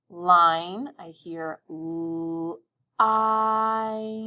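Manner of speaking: "line" stretched, then "l-" and a long held "I"; sounding out line. Shown as a demonstration. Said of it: "Now I heard my I say its name".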